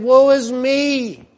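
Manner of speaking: slow, loud anguished cry; cried out. Acted. Preacher voicing Isaiah's slow cry of woe. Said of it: "He said".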